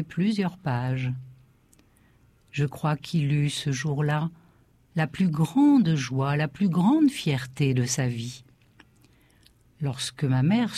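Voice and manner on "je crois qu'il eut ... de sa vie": plain reading voice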